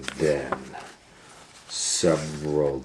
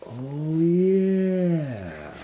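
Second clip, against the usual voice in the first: high drawn-out greedy croon; gloating; 12 semitones higher than usual. Acted. Doctor Varmint relishing the money, high gloat. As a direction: savor the thought.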